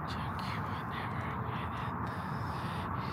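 hushed whisper. Real, not acted. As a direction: whisper it under breath.

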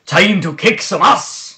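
hammed-up Scottish brawler battle cry; a brawler in a put-on Scottish accent; playful Scottish accent; mock Scottish brawler. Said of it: "I wanted to be the Scottish brawler".

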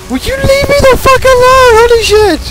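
high, wailing complaint; exasperated outburst. Real, not acted. Gamer to the dog that keeps following, exasperated.

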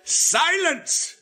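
high, forceful bellowed command; strained, high-pitched bellow. A narrator's reading. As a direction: cut them off with a bellow. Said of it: "bellowed with an extraordinary force".

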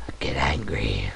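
low breathy whisper, half joking; whispered mock anger. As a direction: whisper it like a fake confession. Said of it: "I get a bit emotional".

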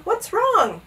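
high, light question; smiling. A narrator's reading. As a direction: ask with an unworried smile. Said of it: "smiled the captain".

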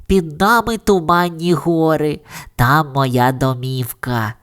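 ordinary delivery, nothing marked

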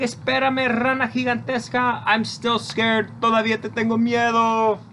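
put-on play-scared for the kids; mock fright. Storytime leader pretending to be scared of the giant frog, hammed-up panic.

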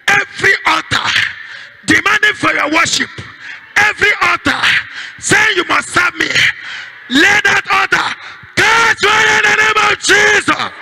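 high, shouted prayer declarations; fiery preacher leading the congregation in prayer; full-force preaching. Real, not acted. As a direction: thunder commands against curses, phrase by phrase.

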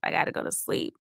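breathy whispered plea; whispered self-urging in the dark. Acted. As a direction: whisper it into the pillow. Insomniac thoughts voiceover.

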